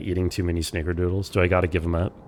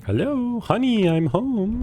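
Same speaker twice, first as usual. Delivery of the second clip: high, drawn-out mock homecoming call; long high-pitched "I'm home" holler; singsong calling out; 13 semitones higher, slower than usual. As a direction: call out playfully, high and slow. Calling out on arriving in game.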